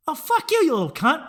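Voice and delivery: high, loud angry yell at a troll. Retold or imitated. Answering a rude TikTok kid with a loud angry yell. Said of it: "And I got all angry".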